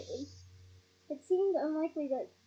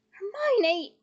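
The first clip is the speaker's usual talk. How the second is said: raised, louder cry of a name; urgent calling out; 6 semitones higher, 7 dB louder than usual. A narrator's reading. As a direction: call sharply to stop a friend. Harry calling out to stop her.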